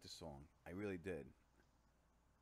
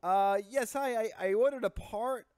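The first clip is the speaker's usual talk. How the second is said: raised, loud, exasperated caller; annoyed; 8 semitones higher, 7 dB louder than usual. Pretend. Customer repeating the part order, annoyed. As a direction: push to be heard, high and loud. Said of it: "I was getting annoyed".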